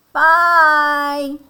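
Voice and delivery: high, loud, long-held goodbye; baby chicks' farewell. Acted. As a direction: call it out as little chicks. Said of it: "the baby chicks say".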